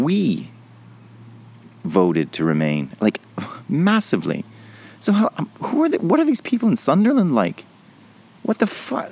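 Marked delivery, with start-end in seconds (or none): 5.0-9.1 much higher, baffled disbelief; real outrage, much higher pitched; incredulous. Real, not acted. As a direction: ask it in utter disbelief. Disbelief at Sunderland voters.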